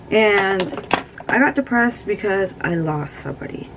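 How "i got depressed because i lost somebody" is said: ordinary delivery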